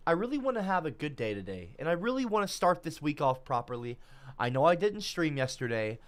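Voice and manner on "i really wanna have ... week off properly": usual speaking voice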